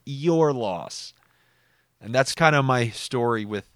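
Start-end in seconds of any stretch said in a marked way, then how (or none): 0.1-1.1 "your" drawn out with heavy emphasis; all-caps "your". Retold or imitated. Telling a losing gambler, emphatic "your". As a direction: punch "your", let "loss" fall away. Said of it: "all caps".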